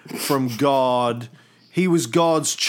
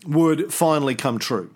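normal talking voice

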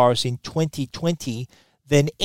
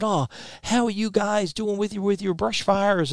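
lightly voiced woman, higher and softer; imitated concerned questioner; 7 semitones higher, 4 dB quieter than usual. American woman to an Australian visitor, lightly imitated concerned question. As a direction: retell her question in a raised, softened voice. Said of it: "the woman heard my Australian accent and she said".

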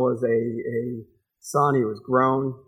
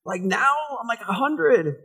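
higher, mock-disbelieving old man; incredulous old Abraham; 6 semitones higher, slower than usual. Abraham protesting to God, mock higher incredulous voice. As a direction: play Abraham doubting God. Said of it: "He's older and going".

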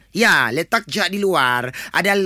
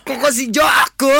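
mock outraged shout; 5 semitones higher, 3 dB louder than usual. Mock outrage at being called.

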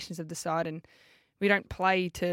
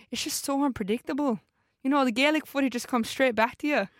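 a cringey, higher-pitched attempt at an Irish accent; bad Irish accent; 7 semitones higher, 4 dB louder than usual. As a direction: put on a clumsy Irish lilt to quote her. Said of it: "I am cringing at myself".

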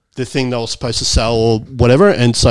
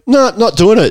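higher, firm no; defiant refusal; 8 semitones higher, 3 dB louder than usual. Retold or imitated. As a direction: refuse flatly and high. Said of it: "And I was like".

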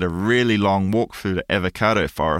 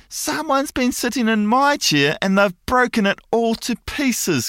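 put-on much higher, whimpering baby bear voice; crying Baby Bear; 14 semitones higher than usual. A narrator's reading. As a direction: wail it like a tiny upset cub. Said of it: "cries the Baby Bear".

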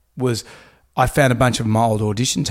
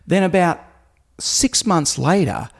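usual speaking voice

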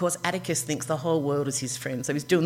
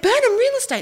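high, loud call across the street; 5 dB louder than usual. Retold or imitated. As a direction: call it out to the driver. Calling out to the car.

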